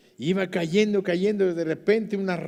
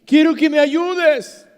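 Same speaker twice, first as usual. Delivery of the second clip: pleading; 11 semitones higher, 12 dB louder, slower than usual. Retold or imitated. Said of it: "climber begging God".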